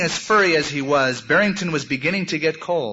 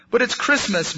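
higher-pitched, mild moan; 7 semitones higher than usual. A narrator's reading. Lonely bunny protests to the wolf, mildly moaning. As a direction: complain with a touch of misery. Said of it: "moaned Barrington".

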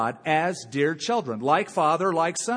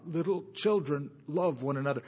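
soft, softer and flatter, a worn repetition; same soft refrain; 6 dB quieter than usual. Retold or imitated. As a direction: say it quietly like a tired refrain. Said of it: "It's like the same tape over and over again".

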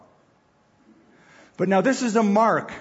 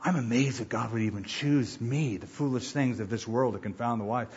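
softer, hushed wonder at being chosen; humble awe; 4 semitones lower, 6 dB quieter than usual. Retold or imitated. Believer amazed God would choose them, hushed awe. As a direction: say it like a grateful newcomer, almost under your breath. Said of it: "you start out going".

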